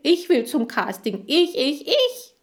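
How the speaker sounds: high, loud, climbing insistence; building to a squeal on the last word; clamouring demand. Acted. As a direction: demand it like a jealous child. Chan demands to be picked.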